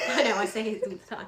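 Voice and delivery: laughing through the words; a real burst of laughter, then talking through it. Real, not acted. Laughing at own repeated advice.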